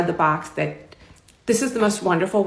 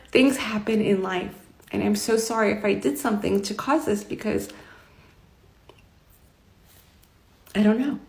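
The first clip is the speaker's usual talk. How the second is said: tearful retelling; 6 dB quieter than usual. Retold or imitated. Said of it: "like, we were crying".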